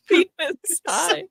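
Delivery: giggling through it; laughing, high and slow. Real, not acted. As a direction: repeat it while cracking up. Laughing repeat of phrase.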